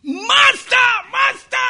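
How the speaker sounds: high, loud excited cry; boy shouting. Retold or imitated. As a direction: call out to your master from afar. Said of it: "he heard the boy shouting".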